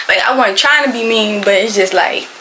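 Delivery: ordinary talk, nothing marked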